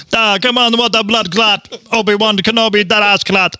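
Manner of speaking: hammed-up Jamaican accent; playful mock Jamaican accent. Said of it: "a Jamaican accent".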